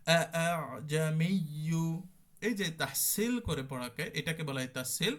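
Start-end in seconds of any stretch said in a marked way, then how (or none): 0.1-1.8 first আ full, second আ softened and joined to the আইন; tashil recitation. Shown as a demonstration. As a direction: recite জামিয়ু with the second hamza softened, loud and clear. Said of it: "পরেরটাতে একটু নরম হয়ে যাবে".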